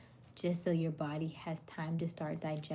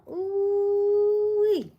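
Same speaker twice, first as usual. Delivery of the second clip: high, loud sustained ooh-wee; remembering a lovely shower with a long, high, loud ooh-wee; delighted squeal; 12 dB louder than usual. Real, not acted. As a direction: let it ring out in pure delight. Blissful shower memory.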